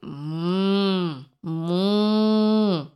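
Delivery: long, droning storybook moo; hummed, drawn-out moo; cow moo. A narrator's reading. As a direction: imitate a cow mooing. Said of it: "said the cow".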